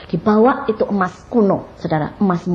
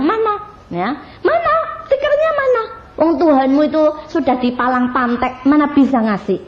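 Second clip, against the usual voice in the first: high, mocking taunt with a laugh; 6 semitones higher than usual. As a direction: jeer at the child's faith. Teman-teman mengejek anak itu. (ejekan mengolok-olok dengan nada tinggi)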